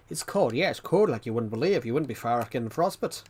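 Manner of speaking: put-on thick, swooping Irish accent. Imitating the Irish guy on the snow, Irish accent. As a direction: imitate an Irishman with a broad lilt. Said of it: "Irish guy on the snow".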